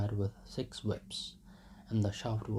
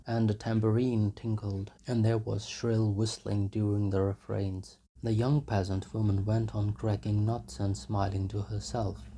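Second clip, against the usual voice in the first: plain reading voice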